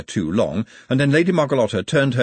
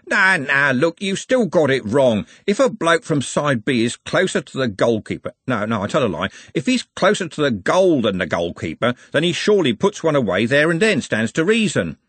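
raised, emphatic pub-table arguing; argumentative football bloke; 5 semitones higher, 4 dB louder than usual. A narrator's reading. Bloke correcting others on the offside rule, argumentative. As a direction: argue it out like a know-all fan.

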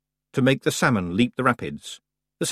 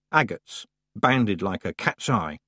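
ordinary delivery, nothing marked

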